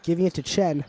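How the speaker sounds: lively live commentary, building excitement; play-by-play. Acted. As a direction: call the game like a sports announcer. Amateur play-by-play of a game.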